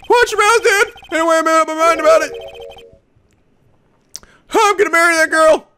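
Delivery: high-pitched huffy dude; Larry, indignant. Acted. Lovesick suspect declares he will marry her, high and defiant. Voices Larry defending his crush.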